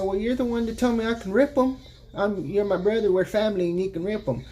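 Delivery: high put-on sister voice, sassy. Brother voicing grown sister talking back, high and sassy. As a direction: raise the pitch, retort like his sister.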